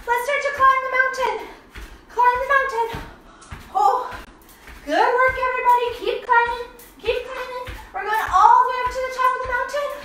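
high, bright-pitched cheering on; high-pitched, eager coaching; playful climbing encouragement. Pretend. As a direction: urge little kids up a pretend mountain. Leading children up pretend mountain.

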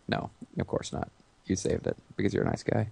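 murmured, almost whispered; hushed aside. Real, not acted. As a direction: drop to a quiet aside. Sarcastic aside after joke.

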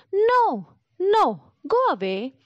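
high, squeaky little pigs crying no; frightened piglets shooing the wolf away; scared little pigs. A narrator's reading. Little pigs to the wolf at the door: high, frightened no.

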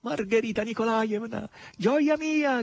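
high, joyful shout; joyful shout to mistress; gleeful cry. A narrator's reading. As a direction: call out in delight. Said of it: "gridava Natascia".

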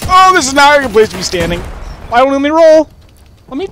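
fast, high frantic complaining; panicked. Real, not acted. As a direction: rush it out in rising panic. Stuck under fire in game.

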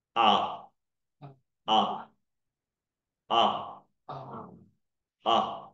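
啊 said with the mouth wide open, repeated slowly; teacher modelling the open ah vowel. Shown as a demonstration. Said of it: "嘴巴张开".

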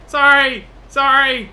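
loud, high hurried sorry; called-out apology. Real, not acted. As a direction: yell sorry to someone you just hit. Calls apology to player hit.

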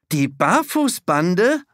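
surprised. A narrator's reading. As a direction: stretch "Barfußbande" in surprise. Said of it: "Man kann hören, wie überrascht sie sind".

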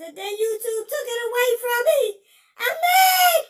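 loud, high, fast sobbing yell; crying shout. Acted. Ranting to Dan about YouTube taking his channel, sobbing shout. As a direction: wail it out, crying and furious. Wailing over lost channel.

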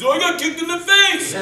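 high, loud wail of complaint; mock outraged yell. Stunt driver after the kick, imitated outraged yelling. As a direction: cry out in exaggerated indignation.

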